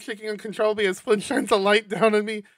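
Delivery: ordinary delivery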